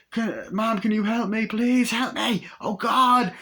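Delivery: high, wailing begging; hammed-up high panic; mock desperate plea. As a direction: imitate pleading frantically for help. Victim begs at neighbour's house.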